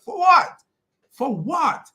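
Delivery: high, loud exasperated demand; indignant. Real, not acted. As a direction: fire it off in real disbelief. Indignant rant at the threats.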